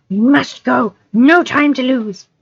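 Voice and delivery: high, slow, hissing creature voice; urgent Gollum. Acted. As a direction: drag them on like Gollum. Gollum urging hobbits.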